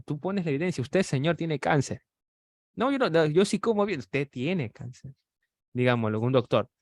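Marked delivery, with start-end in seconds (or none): 2.8-4.0 higher protesting denial; a patient refusing the doctor's verdict, defensive. Acted. Patient voiced denying diagnosis. 4.0-5.1 slow and softer, each word weighed: Usted tiene cáncer; a doctor repeating the verdict slowly; slow, firm diagnosis. Acted.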